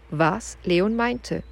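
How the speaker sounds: plain reading voice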